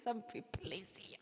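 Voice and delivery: breathy whispered thanks after a laugh. Real, not acted. Streamer whispering thanks to chat after her song. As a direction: whisper it, touched.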